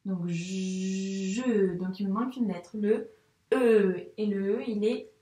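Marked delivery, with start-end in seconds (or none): 0.3-1.8 "je" with a very long buzzing j; sounded-out syllable. Shown as a demonstration. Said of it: "on entend bien le son j et le son e". 3.5-4.1 "e" held loud and long; stretched, louder "e"; isolated sound. Shown as a demonstration. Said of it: "le son e".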